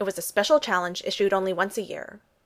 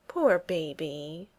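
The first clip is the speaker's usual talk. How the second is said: slow, drawn-out teasing coo; mock sympathy; 3 semitones lower, much slower than usual. A narrator's reading. Natasha teasing Steve in mock pity. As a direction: pity him, but make fun. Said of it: "Natasha said".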